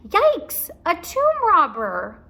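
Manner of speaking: high, loud, slow yelp; scared reading voice. Shown as a demonstration. Teacher re-reading Annie's line, frightened yelp. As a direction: repeat the fright with feeling. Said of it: "in my reading voice".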